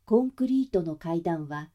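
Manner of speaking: plain reading voice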